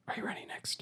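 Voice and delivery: whispered question; hushed whisper to the player. Real, not acted. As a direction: breathe it with no voice. Host whispers to contestant.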